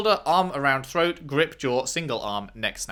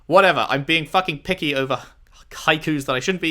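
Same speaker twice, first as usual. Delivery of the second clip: ordinary delivery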